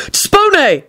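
high quick spell cry; quick yell. A narrator's reading. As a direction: snap out the spell. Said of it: "Neville yelled quickly".

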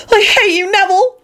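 very high, furious squeal. A narrator's reading. Hermione turning on Neville, squealing. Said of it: "Hermione squealed".

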